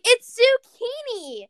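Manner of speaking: high, slow, drawn-out excited shout; excited exclamation. Retold or imitated. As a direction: blurt it out like a discovery. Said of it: "You were just like".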